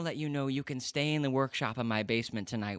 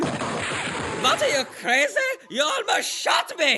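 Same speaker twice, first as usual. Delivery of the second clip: much higher, louder shouting in alarm; panicked shout; 11 semitones higher, 7 dB louder, slower than usual. Acted. Scientist nearly shot by Frank, high alarmed shouting. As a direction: yell in outrage after dodging a bullet.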